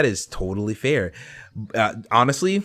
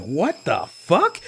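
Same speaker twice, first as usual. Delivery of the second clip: slow stunned what the fuck; drawn-out disbelief, higher; shocked reaction; 6 semitones higher, much slower than usual. Retold or imitated. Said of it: "I was like".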